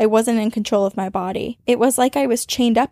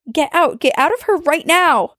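loud, higher, furious order; angry command; 6 semitones higher, 4 dB louder than usual. Retold or imitated. Ordering the thing out of her friend, loud command. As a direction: drive the demon out.

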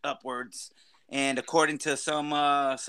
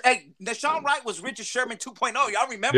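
excited; 8 semitones higher than usual. Real, not acted. Excited hype about a player.

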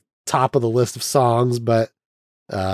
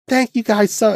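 imitating Lena, pitch pushed much higher; Lena's voice; 12 semitones higher than usual. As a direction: play the starlet whose speaking voice shocks everyone, way up high. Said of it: "weirded out by her voice".

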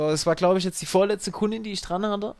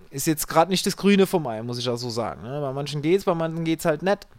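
ordinary delivery

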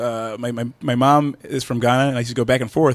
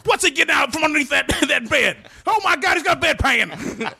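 wrestling commentator; 12 semitones higher than usual. Pretend. Commentator bit continues.

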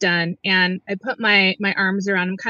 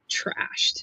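whispered; 9 dB quieter than usual. Real, not acted. Telling about drunk dad.

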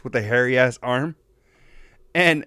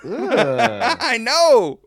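laughing; 9 semitones higher, 6 dB louder than usual. Real, not acted.

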